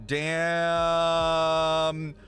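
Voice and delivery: ordinary talk, nothing marked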